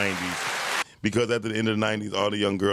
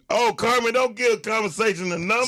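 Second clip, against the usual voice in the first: alarmed plea; 13 semitones higher than usual. Real, not acted.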